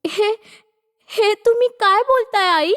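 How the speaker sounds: stunned daughter-in-law, voice lifted; shocked disbelief. Acted. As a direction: ask in hurt disbelief. Shocked at mother-in-law's cruel orders.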